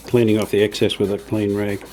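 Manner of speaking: ordinary talk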